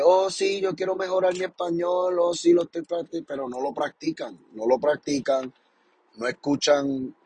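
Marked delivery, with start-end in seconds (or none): 0.0-3.2 higher, singsong mock of people making excuses; mock excuse-maker. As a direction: parody someone who claims to practise. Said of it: "Hay gente que dice". (imitando con tono burlón a quien dice que practica)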